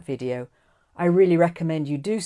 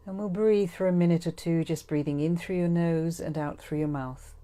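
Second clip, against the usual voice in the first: guided meditation; 8 dB quieter than usual. Acted. Guide settling listeners to breathe before forgiveness, guided meditation voice. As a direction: ease them gently into calm, trailing softer.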